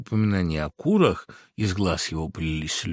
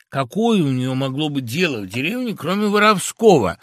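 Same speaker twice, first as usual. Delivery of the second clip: raised, louder, cross accusation; angry; 5 semitones higher, 5 dB louder than usual. A narrator's reading. Woodpecker accusing fox of thieving, angry. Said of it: "говорил сердито дятел".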